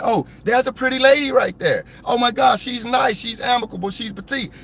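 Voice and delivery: high, gushing mock admiration; mock admiring. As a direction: coo over her like a charmed fan. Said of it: "I'm not saying".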